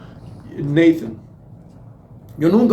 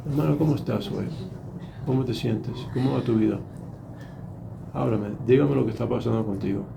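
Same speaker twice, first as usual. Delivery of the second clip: softer, caring questions; gentle concern; 5 semitones lower, 8 dB quieter than usual. Shown as a demonstration. God gently asking a brother how he is. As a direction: ask gently, like a worried friend. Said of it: "Dios nos conoce como somos".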